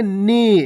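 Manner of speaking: नी with a long drawn-out ई; long nee. Shown as a demonstration. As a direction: stretch the vowel in नी. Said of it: "देखो खींच के करेंगे".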